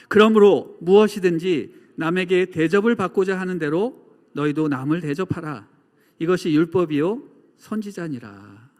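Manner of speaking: loud, declaimed Bible verse; scripture reading. Acted. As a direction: read the verse out firmly for a congregation to follow. Reads verse aloud with congregation.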